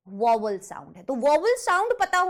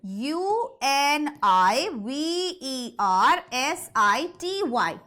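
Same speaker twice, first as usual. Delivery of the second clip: slow, letter-by-letter spelling; much slower than usual. Shown as a demonstration. Teacher spelling university for the class, slow separate letters.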